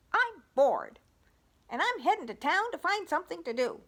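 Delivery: high, loud, fast clucky hen voice; hammed-up bored hen. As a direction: squawk it like a restless hen. Hen character declares boredom.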